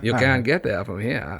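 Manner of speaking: put-on light Maine accent, Down East flavor; a hint of a Mainer's Maine accent. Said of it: "Your Mainer accent?".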